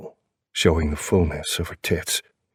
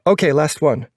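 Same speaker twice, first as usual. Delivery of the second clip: female character voice; 10 semitones higher, 4 dB louder than usual. A narrator's reading. Narrator voicing girlfriend's line.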